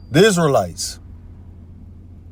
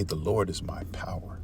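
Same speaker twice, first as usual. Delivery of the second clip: whisper; 6 semitones lower, 11 dB quieter than usual. Real, not acted. Reverent reading of scripture verse.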